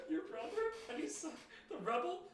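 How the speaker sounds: high, laughing and incredulous. Real, not acted. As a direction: laugh out each question.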